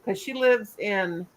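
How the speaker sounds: normal talking voice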